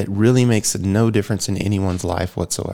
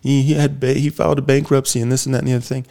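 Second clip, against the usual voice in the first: lightly mimicked moaning complainer, pitched up; higher-pitched mock bellyaching; mock griping; 5 semitones higher than usual. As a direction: rattle off a dismissive imitation of whiners, higher. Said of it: "you can bitch and moan and say".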